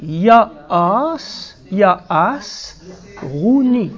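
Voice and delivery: teacher sounding out "Yaas, Rouni" for learners; slow sounding-out. Shown as a demonstration. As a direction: read out "Yaas, Yaas, Rouni" slowly, stretching each syllable. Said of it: "essayez un petit peu de le lire".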